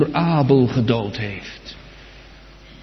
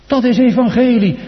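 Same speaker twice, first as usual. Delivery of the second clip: higher, ringing out "evangelie" with a falling close; proclaimed; 9 semitones higher, 5 dB louder than usual. Acted. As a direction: announce it to the whole church. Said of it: "Maar dat is evangelie".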